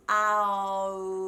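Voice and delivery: long, held "Ow" to start the O; loud, stretched "Ow" glide; mixed-accent O. Shown as a demonstration. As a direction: demonstrate "Ow" as Americans mistakenly start it. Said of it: "They'll start off saying".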